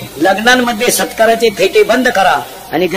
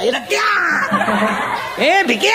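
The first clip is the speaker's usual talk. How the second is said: high drawn-out mock shouts; drunken name-calling; 8 semitones higher, 6 dB quieter, much slower than usual. Mocking drunk boys calling each other by fathers' names, high shouts. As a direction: holler across like drunk boys. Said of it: "बापाच्या नावानं हाका मारायचा".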